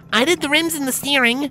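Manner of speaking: put-on very high child pony voice; proud filly. A narrator's reading. A filly boasting about her part, high and proud. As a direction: say it with pride.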